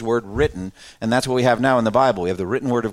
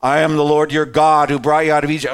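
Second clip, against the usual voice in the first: booming, louder divine voice; voice of God; 2 semitones higher, 4 dB louder than usual. Retold or imitated. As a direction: make it sound like it could melt metal. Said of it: "said in this voice that would, like, melt metal".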